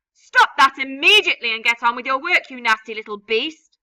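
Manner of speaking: loud, harsh command; loud scolding aunt; angry order. Acted. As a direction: bark the order at him. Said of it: "Aunt Sponge ordered".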